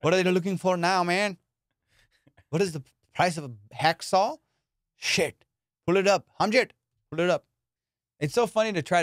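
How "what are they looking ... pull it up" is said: Indian call center guy. Retold or imitated. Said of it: "some guy in New Delhi going".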